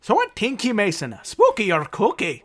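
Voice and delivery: hammed-up caricature Irish brogue; a second go at the Irish lilt; Irish accent. Host redoing his line to Mason in caricature Irish. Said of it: "I can do it in Irish here".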